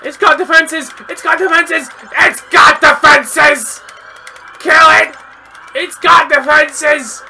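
high, loud, slow yells; frantic gamer yell; panicked shouting. Real, not acted. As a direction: scream the warning. Panicked yelling in game.

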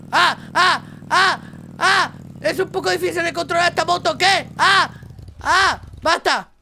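high, loud yelps while losing control; panicked yelps. Real, not acted. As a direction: yelp at the wild ride. (gritos de pánico, agudos y fuertes)

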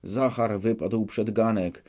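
usual speaking voice

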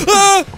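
short, loud high scream; startled yell. Real, not acted. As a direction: yelp at a sudden scare. Jump scare.

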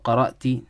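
قرأتي said "qara'ti", ending in "i"; rejected "ti" reading. Shown as a demonstration. Teacher rules out a reading, "qara'ti" for قرأتي. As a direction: close قرأتي on "-ti". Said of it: "There's four ways of reading this word".

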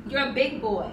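ordinary delivery

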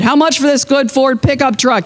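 high-pitched call for bids; auctioneer calling. A narrator's reading. Auctioneer asks crowd for bids.

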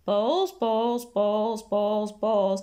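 low, monotonous repetition; muttering. A narrator's reading. Dazed teacher ignoring the giggling kids, muttering. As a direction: drone it to yourself. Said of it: "he carried on muttering".

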